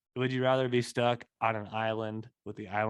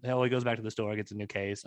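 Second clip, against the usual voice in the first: normal talking voice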